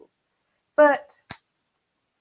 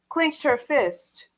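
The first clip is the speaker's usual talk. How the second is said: plain reading voice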